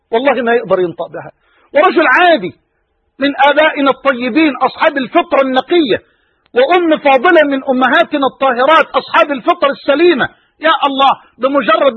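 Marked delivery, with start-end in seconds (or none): none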